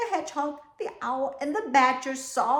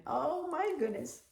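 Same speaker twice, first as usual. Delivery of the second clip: mock amazement; 5 semitones higher, 6 dB quieter than usual. Amazement shown to children.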